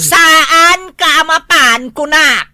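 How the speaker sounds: loud, slow, stern demand; angry scolding. Acted. Wife scolding husband about going out drinking, slow loud scolding. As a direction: lay down the law, word by word.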